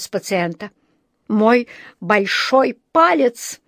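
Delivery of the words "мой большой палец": stretched out, higher, word by word; slow, pained indignation. A narrator's reading. Dentist accusing the patient who bit him, slow and indignant. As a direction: spell out the injury slowly. Укушенный врач обвиняет пациента.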